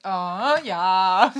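loud, drawn-out pleasure moan; blissful relief. Real, not acted. Co-host getting the cold roll-on on the head, loudly blissful. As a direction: let out a big satisfied "oh yeah".

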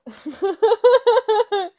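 loud high burst of laughter; shocked laugh. Real, not acted. Cohost hears the story was a lie, loud laugh. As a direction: laugh out in disbelief. Reacting to revealed fake story.

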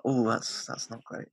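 quiet, trailing letdown; disappointed reaction. Retold or imitated. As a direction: let it sink into a soft, unimpressed murmur. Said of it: "I was like".

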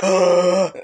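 loud acted gasp; big retold gasp of shock. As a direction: gasp aloud in horror, retelling it. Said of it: "so I was like".

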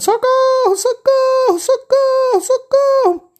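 put-on little girl fleeing in terror; screaming for help. (menina gritando por socorro)

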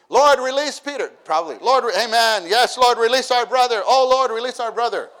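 high, loud, pleading prayer; fervent prayer imitation. Church praying hard for jailed Peter, hammed-up fervent pleading. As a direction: beg the Lord like a revival crowd.

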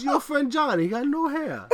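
put-on high, loud childlike telling; little son's voice. Dad imitates his small son pointing out a bald man, high childish voice. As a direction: chirp it like a curious little boy.

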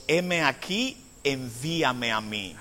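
"Heme aquí" said low and soft, "envíame a mí" sinking to a hush; "envíame a mí" said softly and slowly, low; quiet. Acted. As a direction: murmur "Heme aquí, envíame a mí" as a humble offering. Same words, quiet retelling.

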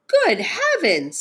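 high, slow exclamation; astonished. Acted. Frog recognizing the horned thing, astonished.

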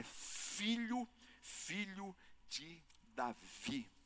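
very slow, soft, word by word; weighty emphasis. Real, not acted. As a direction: linger on "filho de Davi". Preacher stresses the title after the beggar's cry.

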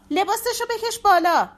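desperate shrieking repeat; shrill repeated shout. Acted. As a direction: scream it again, voice cracking. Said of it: "شمسی داد زد".

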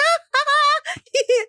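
very high, mock cackle; imitated laughing. Mom imitating her son laughing at her, high mock laugh. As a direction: mimic someone losing it with laughter. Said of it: "And he's laughing".

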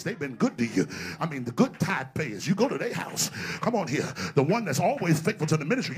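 normal talking voice

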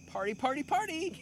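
high shrieking party call; party girl shout. Retold or imitated. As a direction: squeal it like a wild party girl. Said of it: "the door Delano".

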